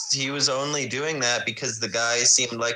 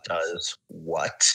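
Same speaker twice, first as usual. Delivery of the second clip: low, slow, menacing murmur; low simmering threat, held under the breath; growling under breath; 6 semitones lower, 5 dB quieter, much slower than usual. Acted. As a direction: growl it quietly, word by word. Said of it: "He starts growling under his breath".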